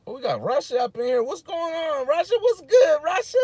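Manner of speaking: high, loud hype shout-out; excited greeting. Real, not acted. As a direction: greet the chat with big hype.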